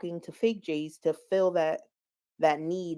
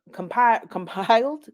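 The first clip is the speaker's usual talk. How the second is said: compiled said with a real laugh, pitched up and louder; 4 semitones higher, 8 dB louder than usual. Real, not acted. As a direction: laugh breaks into the word. Laughing through a stumbled word.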